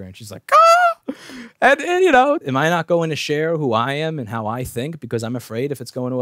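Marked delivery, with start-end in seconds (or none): 0.5-1.1 very high, loud "ah"; imitated scandalised squeal; mock outraged shriek. Coworker hearing the home wrecker jab, mock outraged shriek.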